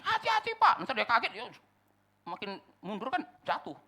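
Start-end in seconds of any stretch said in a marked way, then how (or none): 0.0-0.8 high, loud shouted caution; acted warning shout. Painter shouting a warning to the owner, alarmed. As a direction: call out urgently to stop him. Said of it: "Kalau diteriakin".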